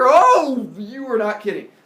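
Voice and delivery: loud high gasp of disgust; startled and loud; shocked. Acted. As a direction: recoil at how ugly they are. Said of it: "steps inside and goes".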